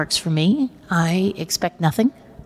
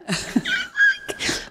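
high, squeaky fake sob; hammed-up tearful squeal; a retold happy cry, high and squeaky; mock crying squeal. Imitating her own sobbing.

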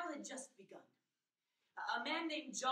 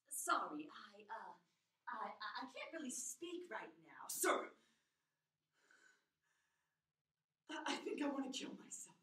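hushed, halting, breath-heavy confession; whispery, hesitant plea on the phone; distressed young caller; 3 semitones lower than usual. Acted. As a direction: struggle to get the words out.

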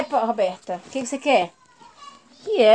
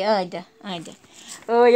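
ordinary talk, nothing marked

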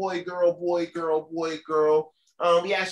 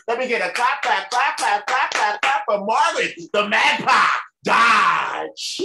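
loud, higher rhythmic clap call; hype-man call; 3 semitones higher, 5 dB louder than usual. Real, not acted. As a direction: pump up the crowd for the next act. Host hyping crowd for comedian.

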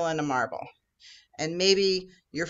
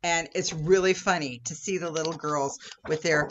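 ordinary talk

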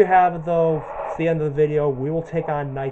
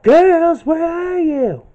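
much higher, louder, slow cartoon tomboy voice; Buttercup impression; 7 dB louder, much slower than usual. Gamer imitates Buttercup calling her sisters, high and slow. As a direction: call out as Buttercup, squeaky and stretched. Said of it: "Let me see if I can do Bo-, Boss Buttercup's voice".

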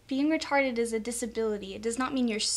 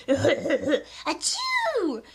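a big open fake sneeze; mock sneeze. People sneezing without an elbow, mock sneeze. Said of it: "they're like".

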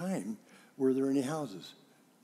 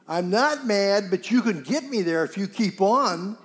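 loud, high, acted outburst; mock losing your temper while denying it; angry denial; 6 semitones higher, 9 dB louder than usual.

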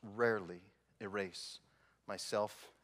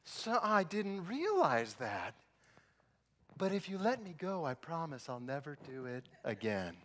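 slow, higher begging; pleading driver; 3 semitones higher, slower than usual. Retold or imitated. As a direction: promise sweetly to be let go. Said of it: "those are the words you say".

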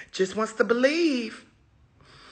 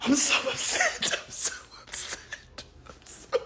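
whispered whimper; 14 semitones higher, 10 dB quieter than usual. Real, not acted. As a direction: whine it breathlessly. Venting frustration.

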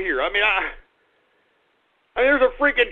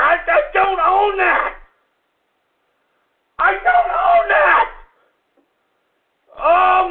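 furious yelling; 5 semitones higher than usual. Acted. Radio host yelling at his dog for crapping on carpet, furious shout. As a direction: lose it completely over the mess.

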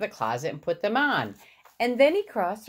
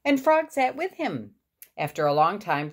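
usual speaking voice

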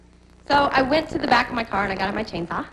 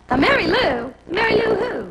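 puzzled; 9 semitones higher, 3 dB louder than usual. Retold or imitated. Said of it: "I said to myself".

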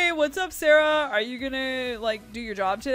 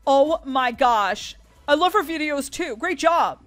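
high, loud squeal of admiration; gushing fan; 6 semitones higher, 8 dB louder than usual. Acted. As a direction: praise your idol, thrilled. Fan meets favorite video maker.

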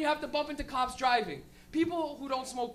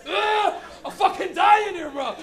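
loud slow strained choke; choking smoker; 7 semitones higher, 11 dB louder, much slower than usual. Retold or imitated. Nonsmoker forced to smoke, loud choking complaint. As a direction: force it out between coughs. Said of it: "coughing smoker in car".

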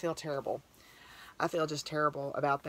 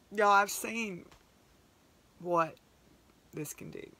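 real emotion tightening the throat, trailing off; choked up; 3 semitones higher, slower than usual. Real, not acted. Telling viewers about helping horse kids, choked up. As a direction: voice catching, fading to a breathy near-whisper at the end. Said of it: "I'm getting kind of emotional".

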